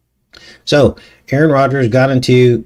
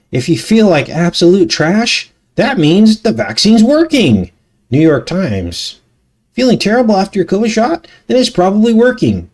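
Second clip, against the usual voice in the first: sarcastic mocking; 8 semitones higher than usual. Mocking the vaccine headline.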